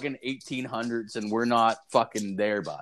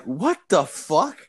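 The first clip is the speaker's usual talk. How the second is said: slow, higher, louder "what the fuck", stunned; retold shock; 5 semitones higher, 5 dB louder, much slower than usual. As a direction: drag it out in disbelief. Finding a box of pepperettes.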